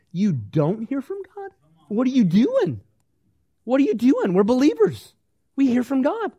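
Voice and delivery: high, rising disbelief at nonbelievers; amazed anyone wouldn't hear God; incredulous. Pretend. Believer baffled at people who don't hear God, incredulous. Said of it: "look at people like".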